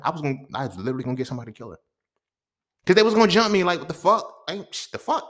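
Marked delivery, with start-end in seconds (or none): 2.9-5.3 loud outraged yell, pitch way up; furious shout. Retold or imitated. Comic yelling at the crowd about being jumped, furious. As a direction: shout it like you're still mad about it. Angry retelling of the fight.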